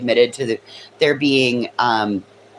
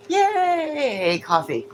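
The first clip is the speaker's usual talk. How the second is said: high soft cheer; 13 semitones higher, 3 dB quieter, much slower than usual. Real, not acted. Coffee cheer.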